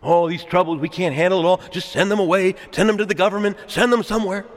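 high, hand-washing complaint; fretful church talk. Acted. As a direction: mimic a church passing off its problems. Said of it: "churches sometimes talk like this".

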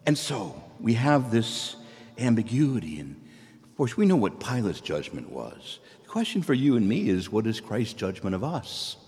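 normal talking voice

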